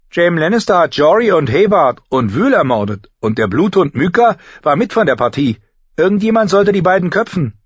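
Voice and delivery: put-on high, loud, heated girl; angry younger sister. Younger sister demands both killers be beheaded, heated. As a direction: accuse them hotly in a high voice.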